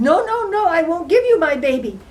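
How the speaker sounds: loud, high-pitched protest; frantic refusal. Acted. Queen refuses the little man her baby, frantic.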